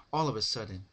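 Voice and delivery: ordinary delivery, nothing marked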